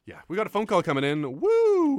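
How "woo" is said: high, loud radio whoop; excited whoop. Real, not acted. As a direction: whoop to hype the caller. Phone call on radio.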